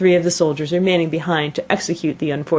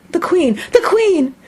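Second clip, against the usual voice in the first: high, raised cry of announcement; calling out; 13 semitones higher, 4 dB louder, slower than usual. A narrator's reading. Gardener warns the others that the Queen is coming, calling out. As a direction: call it out high and urgent. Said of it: "called out".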